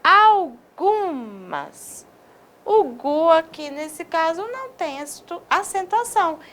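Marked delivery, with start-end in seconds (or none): 0.0-2.1 algumas stretched very slowly, stress on gu; slow stress demo. Shown as a demonstration. Teacher showing a stressed word without an accent mark, very slow. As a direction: draw out algumas. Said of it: "Olha essa agora".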